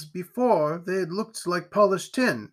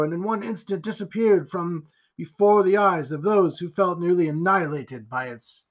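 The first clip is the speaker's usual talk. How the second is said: ordinary delivery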